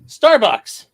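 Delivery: loud punchline. Acted. Punchline shouted brand name.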